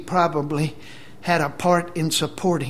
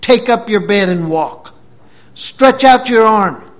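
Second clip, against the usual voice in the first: high, loud, ringing orders; full-force retold command; commanding shout; 6 semitones higher, 12 dB louder than usual. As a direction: command a man to get up. Acts Jesus commanding the paralysed man.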